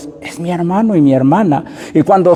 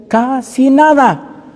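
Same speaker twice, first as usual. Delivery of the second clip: casi nada much higher and slow; stressed casi nada; 9 semitones higher, slower than usual. Shown as a demonstration. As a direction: stretch out casi nada to stress how little. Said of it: "está diciendo".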